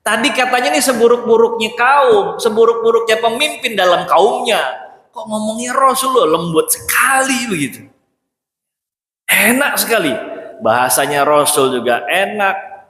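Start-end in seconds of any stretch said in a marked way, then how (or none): 0.1-4.6 puzzled disbelief. Retold or imitated. Aisyah puzzling aloud over the Prophet's gentle words, higher and incredulous. 5.1-10.1 higher, marvelling voice; wondering amazement. Retold or imitated. Aisyah wondering at gentleness.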